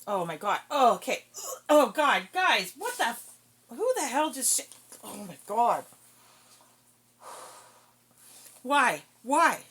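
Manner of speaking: loud, high, grossed-out cries; disgusted yelling. Real, not acted. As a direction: shout in real disgust. Dogs farted, disgusted.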